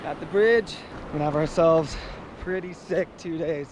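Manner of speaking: ordinary talk, nothing marked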